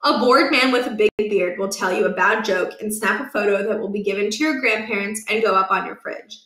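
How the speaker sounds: ordinary delivery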